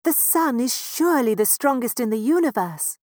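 high, loud doting mouse parent; proud little mouse parent voice. Acted. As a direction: boast about your daughter like a fond mouse father. Parents plan aloud.

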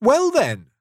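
high, loud and lively; cheerful reply. A narrator's reading. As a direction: bounce back cheerfully at your uncle. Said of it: "the nephew replied cheerfully".

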